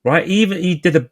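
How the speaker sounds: normal talking voice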